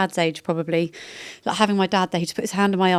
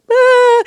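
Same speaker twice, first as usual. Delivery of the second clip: loud, very high wail; mock wail; 12 dB louder than usual. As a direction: burst into an exaggerated high wail. Re-enacts breaking down on the phone.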